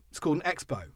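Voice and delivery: Expo said as "EX-bow"; spelling pronunciation. Shown as a demonstration. Host insists on reading the spelling, Expo said X-bow. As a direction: say the letter X then bow for "Expo". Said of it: "Because that's how they've spelt it".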